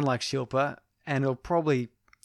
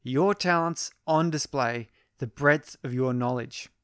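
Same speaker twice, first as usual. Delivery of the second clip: ordinary talk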